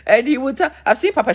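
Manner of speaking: lifted, rising lead-in; winding up to preach; excited. Retold or imitated. Telling about Papa She, excited build-up.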